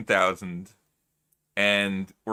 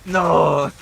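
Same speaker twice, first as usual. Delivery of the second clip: sudden loud cry of no; 4 semitones higher, 11 dB louder than usual. Pretend. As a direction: bark out the no. Loud protest in role.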